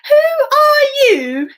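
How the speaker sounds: high, slow frightened cry; a scared little girl calling out; high frightened child's cry. Acted. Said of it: "a little bit frightened".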